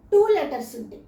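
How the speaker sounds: ordinary delivery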